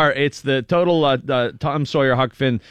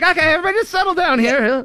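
nervous host; 11 semitones higher, faster than usual. Retold or imitated. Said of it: "he'd be like".

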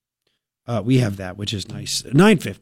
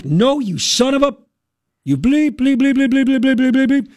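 higher, mock outraged tirade of bleeped curses; mock furious rant; 10 semitones higher than usual. Host mocks enraged liberal cursing a Trump supporter, higher mock rage. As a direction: ham up a raging liberal's spluttering fury.

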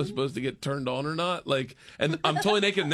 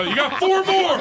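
high, loud imitated call; trainer calling out; 12 semitones higher, 6 dB louder than usual. Trainer calling to man with bear, mock holler. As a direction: holler it like a trainer across a set.

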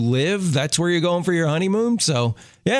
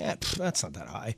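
much softer, lower scoff; dismissive; 5 semitones lower, 13 dB quieter than usual. Acted. Visitor sizing up lighthouse from the bottom, dismissive. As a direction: shrug it off under your breath. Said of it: "you're just like".

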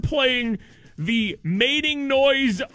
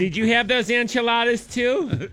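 office lady voice; 3 semitones higher than usual. Pretend. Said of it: "office lady voice".

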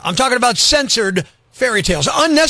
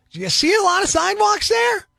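outraged rhetorical question; 13 semitones higher than usual. Real, not acted.

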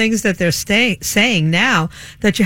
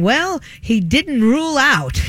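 mocking pundits; 5 semitones higher, slower than usual. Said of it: "they're up there saying".

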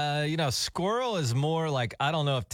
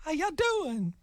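much higher, friendly mock greeting; sweet rock star; 14 semitones higher, slower than usual. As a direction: chirp it like a nice old star. Singer's sweet speaking voice.

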